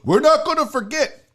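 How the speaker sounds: mock the moaning fans with a gasp; hammed-up whiny fan voice. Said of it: "Dodgers fans have bitched and moaned".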